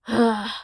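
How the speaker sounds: audible acted sigh. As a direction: let out a breath in defeat. Scout's deflated reaction.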